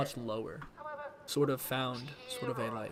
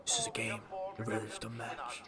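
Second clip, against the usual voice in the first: Battinson impression; 4 semitones higher, 12 dB quieter than usual. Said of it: "He's very low".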